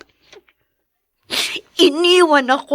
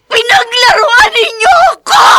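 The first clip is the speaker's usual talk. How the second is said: louder, sobbing accusation; a tearful, furious outcry; crying shout; 7 semitones higher, 7 dB louder than usual. Acted. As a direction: hurl it at them through tears. Accusing family of deceit.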